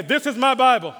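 loud, high call to repeat. Acted. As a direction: lead the church in a loud call. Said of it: "I want you to say it so loud".

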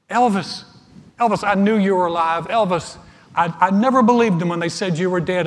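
high, loud star-struck gushing; gushing excitement. Acted. Hotel concierge welcoming the man he thinks is [name], gushing excitedly. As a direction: gush like an overjoyed superfan.

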